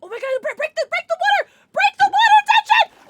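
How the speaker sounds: high, loud frantic shouting; panicked yell. Real, not acted. Drowning in the game, she screams high commands. Panicking under attack, yelling.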